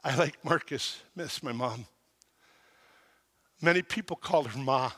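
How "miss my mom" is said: much softer, lower, voice catching; grief. Real, not acted. Preacher missing his late mother, soft and grieving. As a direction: let the loss show quietly.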